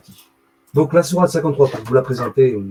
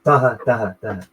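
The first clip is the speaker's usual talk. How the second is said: ordinary talk, nothing marked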